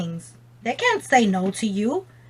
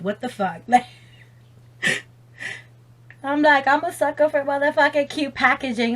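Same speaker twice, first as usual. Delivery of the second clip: normal talking voice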